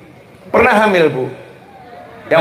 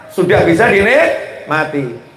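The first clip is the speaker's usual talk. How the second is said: usual speaking voice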